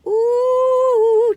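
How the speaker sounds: high, loud ooh of delight. Real, not acted. Tasting the warm cookie, cooing with delight.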